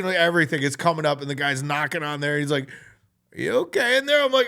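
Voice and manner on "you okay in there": high-pitched call through a door. Retold or imitated. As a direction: check on someone through a closed door. Guy knocking calls through the door.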